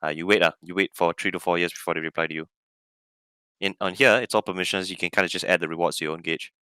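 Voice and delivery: ordinary talk, nothing marked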